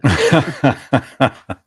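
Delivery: a loud, high burst of real laughter; hearty laugh. Real, not acted. Host laughing warmly at the caller's sign-off.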